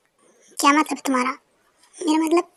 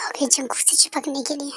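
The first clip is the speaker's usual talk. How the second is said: soft whispered snide remark; whispered aside; 9 dB quieter than usual. Acted. Muttering about the girl clinging to Jungkook, whispered. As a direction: whisper it under your breath.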